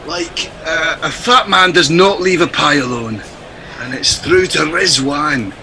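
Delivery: put-on fading Scottish accent barely holding; comedy Scottish commentary, slipping; struggling to keep up a Scottish accent. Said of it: "keep in this accent".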